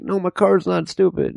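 high, whiny little voice; whiny high plea. Retold or imitated. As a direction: beg to be left alone like a scared kid. Himself arguing back, high voice.

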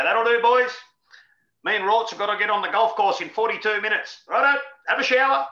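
mock coach dismissing training. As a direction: bark it like a coach in a hurry to golf.

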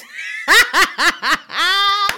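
big high burst of laughter; loud helpless laugh. Real, not acted. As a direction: crack up loudly.

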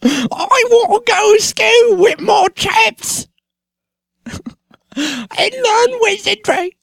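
very high, slow, put-on English schoolboy voice; Daniel Radcliffe impression. Podcast host showing his Harry Potter voice, squeaky and slow. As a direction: camp up a squeaky little English wizard boy. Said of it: "I'm gonna do Daniel Radcliffe".

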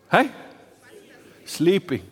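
normal talking voice

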